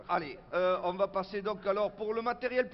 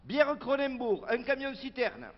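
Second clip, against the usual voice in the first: slow, higher, emphatic item reading; meeting budget announcement; 7 semitones higher, 4 dB louder than usual. Acted. Local official reading the drinks budget to activists, slow proud announcing. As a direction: proclaim each order like a grand policy.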